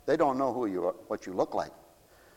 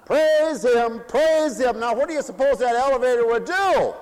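raised, loud preaching voice; preaching at full force; 10 semitones higher, 7 dB louder than usual. Acted. Preacher rousing the congregation with praise, loud and raised. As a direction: call it out to the pews.

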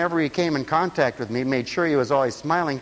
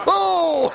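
high exclamation; 13 semitones higher, 5 dB louder than usual. Retold or imitated. Mother's outburst.

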